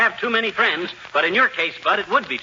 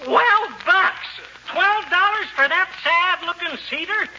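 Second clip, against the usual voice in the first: shocked, much higher squeal of disbelief; outraged disbelief; 9 semitones higher, slower than usual. Acted. McGee balks at the Christmas tree's price, outraged disbelief. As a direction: balk at the price as if robbed. Haggling over overpriced tree.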